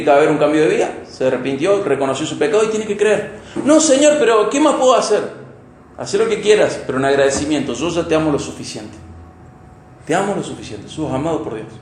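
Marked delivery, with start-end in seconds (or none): 3.6-5.3 pleading protest. Acted. Believer protesting he must do more. 7.7-11.7 tender reassurance. Acted. God answering the believer tenderly.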